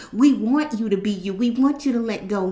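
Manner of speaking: ordinary talk, nothing marked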